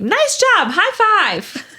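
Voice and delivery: high, loud, gleeful congratulation; imitated toddler cheering. Mom imitating her son praising her potty trip, toddler cheering. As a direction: cheer it like a proud little kid.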